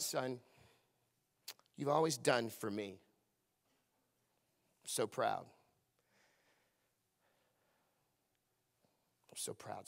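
low, soft grudging father; a hint of a cool, withholding father; mocking father. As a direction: voice the conditional dad, hushed. Mock father voice, contrasted.

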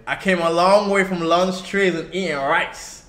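rapping in an American accent. Shown as a demonstration. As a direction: rap it with an attempted American accent. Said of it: "That's me rapping in an American accent".

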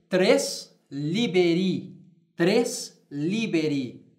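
slow deliberate tres liberi; slow Latin model. Shown as a demonstration. Teacher dictating Latin numeral phrase to class. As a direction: repeat it slowly for dictation. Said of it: "eu direi".

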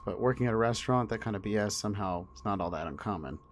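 ordinary delivery

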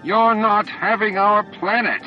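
loud, high, drawn-out angry howl; howled defiance. A narrator's reading. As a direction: howl it out slowly in outrage. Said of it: "Warren howled".

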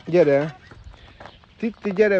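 loud, high summons; calling out. Real, not acted. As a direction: call a dawdling pet over, high and loud. Calling an animal to come.

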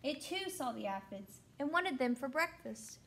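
plain reading voice

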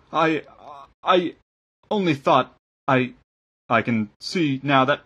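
halting, broken stammer; groaning excuse. A narrator's reading. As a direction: grope for an excuse, cowed. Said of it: "Father Yost groaned".

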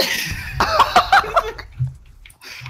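very high, wheezing laugh; helpless laughter. Real, not acted. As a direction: laugh long and high, out of breath. Startled laughter after being hacked.

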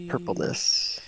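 hushed. Acted. Savoring the odd word.

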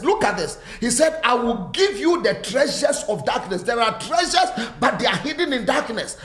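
rising and falling preacher's cadence; fervent preaching. Acted. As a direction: preach it to the whole church with conviction. Preaching to congregation.

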